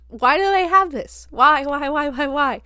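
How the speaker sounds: high, loud, incredulous questioning; exasperated. Real, not acted. Viewer baffled the family kept the clown, exasperated. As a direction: hammer out the whys in exasperation.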